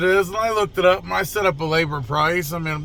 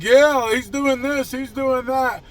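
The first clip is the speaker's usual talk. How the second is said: raised, puffed-up bluffing voice; big talk put on to scare someone; mock swagger; 6 semitones higher, slower than usual. Bluffing mechanic pretending to report the customer, mock big-shot swagger. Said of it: "walking like I owned the world".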